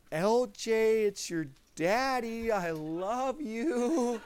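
tender hello to newborn. Retold or imitated. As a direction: greet her gently with a laugh in the voice. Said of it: "So I was like".

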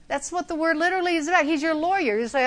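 ordinary talk, nothing marked

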